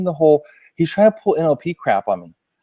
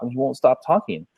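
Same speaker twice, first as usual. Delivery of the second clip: ordinary talk, nothing marked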